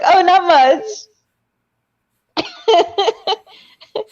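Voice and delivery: mock high answer. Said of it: "I was like".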